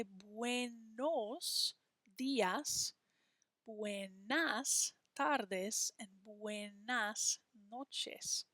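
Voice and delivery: buenos días, buenas tardes, buenas noches, each syllable slow; slow clear model. Shown as a demonstration. Teacher slowly modelling the greetings for young learners. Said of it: "We say".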